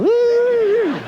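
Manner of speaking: high, loud whoop. Retold or imitated. Comedian pretending to stand on a runway, loud whoop. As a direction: let out a joyful woo. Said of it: "standing on the runway going".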